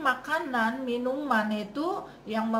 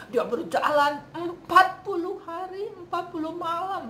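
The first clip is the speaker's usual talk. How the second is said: louder, higher, marvelling at the length; amazed emphasis; 7 semitones higher, 5 dB louder than usual. Real, not acted. Preacher marvelling to listeners at Elia's forty-day walk, amazed. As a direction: stress "empat puluh" with loud, high amazement.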